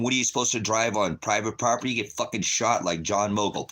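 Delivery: normal talking voice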